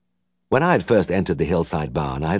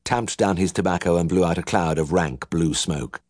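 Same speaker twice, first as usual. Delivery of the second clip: ordinary delivery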